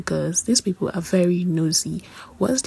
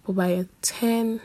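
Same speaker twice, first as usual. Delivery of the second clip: ordinary talk, nothing marked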